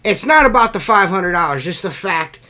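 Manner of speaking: real heated indignation; angry rant. Real, not acted. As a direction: rant as if they were on the line. Ranting at gas company over bill.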